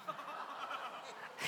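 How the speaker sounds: high airy unvoiced laugh; breathy laugh. Real, not acted. Pastor laughing at the friend's story, airy laugh. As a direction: laugh silently under the breath.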